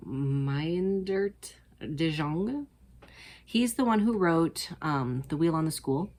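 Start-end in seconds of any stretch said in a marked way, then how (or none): 0.1-2.7 Meindert de Jong sounded out very slowly, syllable by syllable; slow tentative try at Meindert de Jong; careful unsure pronunciation. Shown as a demonstration. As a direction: feel your way through Meindert de Jong. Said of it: "I don't know how to say this name".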